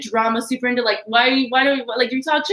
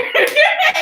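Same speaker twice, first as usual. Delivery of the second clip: loud laugh; 5 dB louder than usual. Real, not acted. Laughing at the song.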